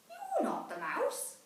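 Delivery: put-on high, disappointed child voice; a small monster let down again; little Gruffalo child. Child meets the snake.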